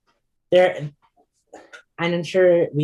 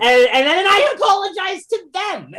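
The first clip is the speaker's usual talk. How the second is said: much higher and louder, laughing through the words; laughing disbelief; 5 dB louder than usual. Real, not acted. Telling host he apologized to the bully, laughing. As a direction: crack up at how absurd it was. Laughing at own absurd reaction.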